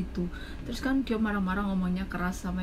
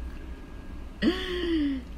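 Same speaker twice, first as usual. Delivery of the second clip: sharp gasp; 10 semitones higher than usual. Real, not acted. Gasp alone after story.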